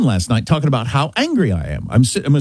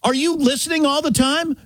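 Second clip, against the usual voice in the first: retold disbelief, pitched up high; incredulous challenge; 10 semitones higher than usual. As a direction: challenge the assistant in a high, questioning voice.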